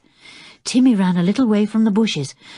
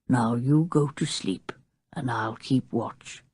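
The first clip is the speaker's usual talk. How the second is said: gentle reassurance; 7 semitones lower, 6 dB quieter than usual. A narrator's reading. Dog's imagined comforting thought.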